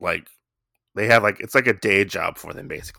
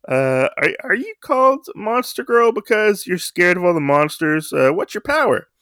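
high, slow mocking coo; mock her as a cute little kid; patronising coo; 7 semitones higher, 3 dB louder than usual. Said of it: "He's like".

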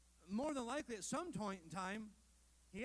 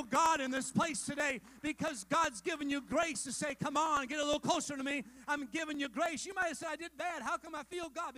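full-force preaching; 7 semitones higher, 11 dB louder than usual. Acted. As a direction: preach it high and loud, calling out to the church.

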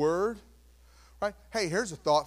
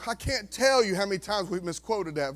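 ordinary talk

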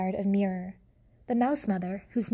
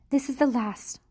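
put-on higher French-accented declaration; French proclamation; 4 semitones higher than usual. Antoinette proclaiming from her bed, French accent. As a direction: proclaim it like a French grande dame. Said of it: "proclaimed Antoinette".

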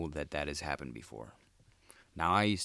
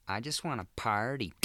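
higher, put-on party-girl voice; 6 semitones higher than usual. Comic mimics Mill Avenue party girls, ditzy voice. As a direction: play a ditzy girl out on the strip. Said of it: "the chicks on Mill Avenue would just be like".

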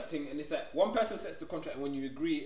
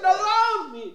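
dismissive quote; 13 semitones higher, 11 dB louder, much slower than usual. Retold or imitated. Retold character's dismissive quote.

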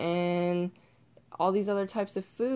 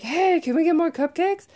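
put-on higher and louder, eager kids' voice; imitating the neighbour girls; 9 semitones higher, 6 dB louder than usual. Neighbour girls asking the host for cupcakes, high eager imitation. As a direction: pitch it up like excited girls begging for treats. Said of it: "they're like".

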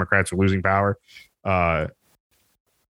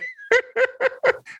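squealing laugh at own story; high burst of laughter. Real, not acted. Telling a friend the story, cracking up in a squealing laugh.

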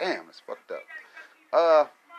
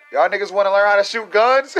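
mock recruiter; 6 semitones higher, 6 dB louder than usual. Mocking American soldiers recruiting locals.

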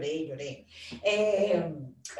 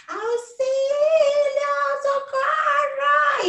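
much higher, louder, slow sung-out call for help; mock cry for help; 10 dB louder, much slower than usual. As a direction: wail for rescue in jest.

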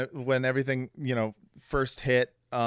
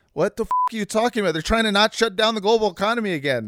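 1 exasperated rant; 8 semitones higher, 3 dB louder, much faster than usual. Retold or imitated. Exasperated retort to conspiracy theorists.

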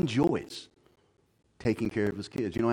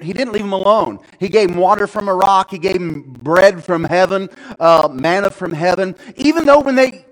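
raised voice, higher pitch, pressing on; impassioned preaching; 8 semitones higher, 13 dB louder than usual. Real, not acted. Preacher telling of forty years in the desert, impassioned. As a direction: preach it out loud to the room.

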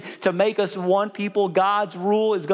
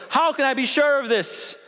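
put-on lightly raised, whiny old man voice; a hint of a fretful old priest; whiny old Zechariah; 7 semitones higher than usual. Preacher voicing doubting Zechariah to the congregation, mildly whiny.

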